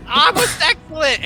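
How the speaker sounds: high, loud, slow delighted exclamation; delighted king cry. Acted. Kobold king thrilled by the diamond gift. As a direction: gush over the gift.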